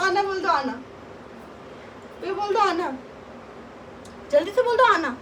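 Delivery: sing-song coaxing. Acted. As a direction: coax the little one in a high voice. Coaxing the child to say come.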